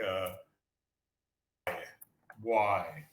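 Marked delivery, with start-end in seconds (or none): 2.4-3.1 put-on low Caribbean "bwoy" for boy, spelt "Why"; Caribbean patois. Shown as a demonstration. Teacher showing Caribbean speech, boy turned to patois "Why". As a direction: say "Why" as a Jamaican-style bwai, pitched lower. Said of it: "they'll say something like".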